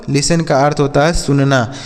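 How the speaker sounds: ordinary talk, nothing marked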